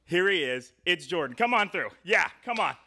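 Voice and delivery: ordinary talk, nothing marked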